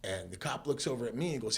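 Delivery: ordinary talk, nothing marked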